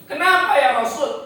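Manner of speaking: put-on high, loud childish why; pleading boy. Boy asking the Prophet why he can't fight, high plea. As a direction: ask like a hurt kid.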